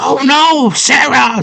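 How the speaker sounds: high-pitched cry of dismay; imitated high worried yelp; mock alarm. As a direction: call her name in hammed-up panic. Hoggle hears Sarah screaming.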